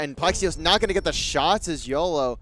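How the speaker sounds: excited call. Real, not acted. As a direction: hype up the missed shots. Excited call of failed play.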